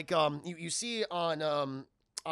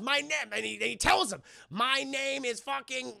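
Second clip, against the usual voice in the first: proud proclamation; 8 semitones higher than usual. Retold or imitated. Killmonger proclaiming name.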